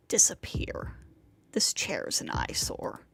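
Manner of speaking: low, hushed seething; near-whispered loathing; seething under the breath. A narrator's reading. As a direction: whisper it with contempt. Narrator seething at the chair.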